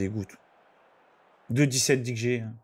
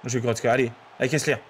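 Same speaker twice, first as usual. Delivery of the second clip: ordinary talk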